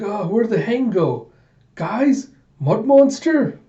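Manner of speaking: high, rising confusion; bewildered calling out. Acted. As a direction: call around for missing friends. Bewildered character calls for others.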